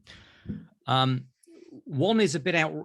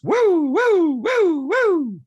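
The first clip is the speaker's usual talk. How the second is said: loud, high whooping siren noise; smoke alarm wail; 12 semitones higher, 7 dB louder, much slower than usual. Shown as a demonstration. Dad showing his son the alarm sound, loud wailing. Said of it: "showed him the sound it makes".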